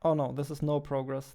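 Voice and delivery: ordinary talk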